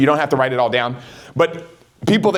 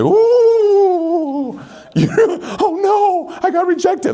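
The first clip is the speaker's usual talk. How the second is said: high, hammed-up whiny mock panic; sarcastic fake fright at a rejection; mock dismay; 3 dB louder, much slower than usual. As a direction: squeal it like a pretend victim. Mocking fear of rejection.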